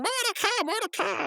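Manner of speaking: high-pitched panicked calling; frantic cartoon voice, way up high; freaking out. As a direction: cry out for help in mock panic. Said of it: "the way he said Mordecai freaking out".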